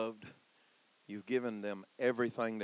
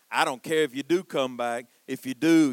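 harsh father; 10 dB louder than usual. Retold or imitated.

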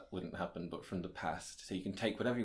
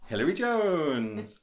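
announcer voice; 5 semitones higher, 7 dB louder, slower than usual. Acted. Grand introduction of guest.

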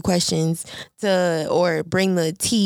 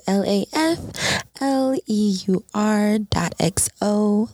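spelled out slowly; slower than usual. Shown as a demonstration. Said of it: "my Instagram is".

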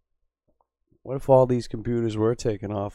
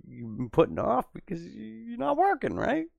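climbing higher into a sing-song; rising mock sympathy; 12 semitones higher than usual. Streamer to viewers, patronizing high sing-song. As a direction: lift it up, patronizing.

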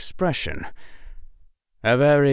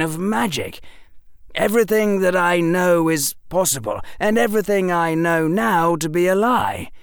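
put-on boyish, eager storytelling; young Harry voice; 7 semitones higher than usual. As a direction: voice a clever young boy. Harry's lines in audiobook.

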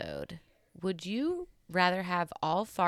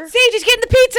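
alarmed shout; 16 dB louder than usual. Real, not acted. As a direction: gasp then a high, loud shout of alarm. Kid grabs the pizza.

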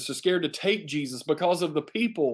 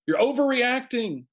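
much higher, mocking disbelief; scoffing retort; 9 semitones higher than usual. Crowd mocks Chicken Little, much higher scoffing. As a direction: toss it back with scorn.

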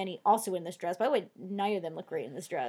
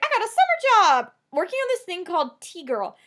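high, loud mock-excited announcement; higher-pitched, pushed-up voice for the quote; chirpy retold Ashley; 9 semitones higher, 7 dB louder than usual. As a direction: imitate her proudly sharing news. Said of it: "she also is like".

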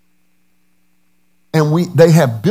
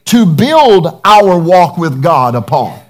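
loud, slow preaching climax; 3 semitones higher, 5 dB louder, slower than usual. Acted. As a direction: build the sermon to full force. Preaching at full force.

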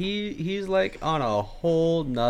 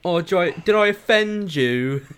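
hammed-up British accent, higher; Ricky Gervais; 5 semitones higher than usual. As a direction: provocative British comic, mock-taunting. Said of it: "replace him with Ricky Gervais".

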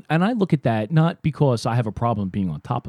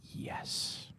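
whisper; 22 dB quieter than usual. Real, not acted. Hushed agreement about French people.

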